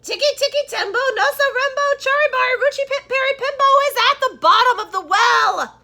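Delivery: very high, very loud desperate shout; a boy shouting his last bit of strength. A narrator's reading. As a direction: yell with your last strength. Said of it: "he shouted".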